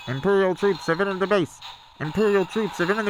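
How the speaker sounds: urgent loudspeaker alert cut off; base alarm. Acted. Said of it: "Then the alert came".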